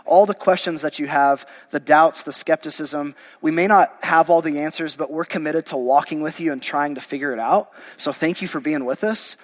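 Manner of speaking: normal talking voice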